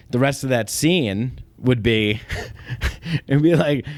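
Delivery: usual speaking voice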